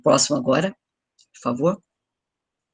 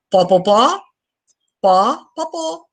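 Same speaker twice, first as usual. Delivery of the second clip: imitating the other man; 5 semitones higher, 5 dB louder, much slower than usual. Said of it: "Olha o que o outro disse".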